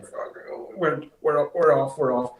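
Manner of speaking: retold panic. Panicking as car nears corner.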